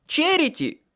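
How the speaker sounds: very high, loud cry of the name; urgent shout. A narrator's reading. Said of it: "крикнул я". (высокий громкий крик)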